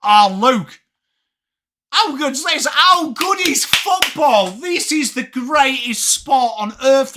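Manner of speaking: high, slow, rapturous praise of the game; gushing excitement. Real, not acted. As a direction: sell it like the best thing alive. Gushing to chat about football.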